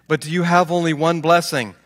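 ordinary talk, nothing marked

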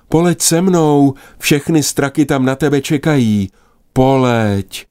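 high, eager sister's voice; an excited little sister urging her home; sister magpie. Acted. Sister Liběna speaks while flying. (vysoký dychtivý hlas sestry Liběny)

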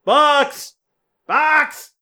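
high-pitched calling out; hallway shout. Acted. Wizard bursts into hallway seeking his owl, calling out. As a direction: call down the hall for a lost pet. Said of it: "shouting in the hallway for Bucks".

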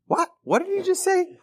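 very high, startled question; squeaky, incredulous reaction; mock shock. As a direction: imitate an aghast parent's double take. Retold shock at child's word.

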